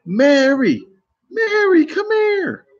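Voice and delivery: put-on shrill calling voice of an older lady; high-pitched older lady calling. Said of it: "Just like that".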